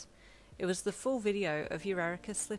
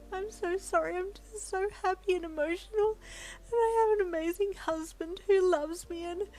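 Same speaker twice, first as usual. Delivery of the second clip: much higher and louder, tearful wail; happy wailing; 13 semitones higher, 7 dB louder than usual. Acted. Wife overcome with joy to the editor, higher, louder wailing. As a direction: let happy tears burst out. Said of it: "you wailed".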